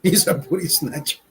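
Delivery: said through a laugh; chuckling the words out. Real, not acted. Repeating a joke while laughing.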